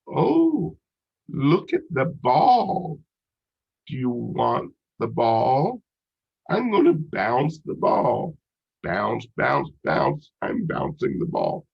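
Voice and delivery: high-pitched, slow, exaggerated parent-to-infant voice; singsong baby talk, loud and drawn out. Shown as a demonstration. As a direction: coo it slowly to a baby, stretching the words in big pitch swoops. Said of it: "where they say things like".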